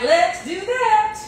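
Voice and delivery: "Let's do that" lifted high and drawn out slowly; sing-song it up high and slow; playful high repeat. Shown as a demonstration. Repeating the oven step to viewers in a playful high voice. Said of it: "repeated in two ways".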